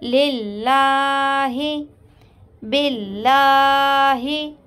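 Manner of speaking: लिल्लाहि and बिल्लाहि with a light, thin lam, slowly recited; tajweed demo, thin lam after zer. Shown as a demonstration. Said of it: "तो इसको बारीक पढ़ेंगे लाम को".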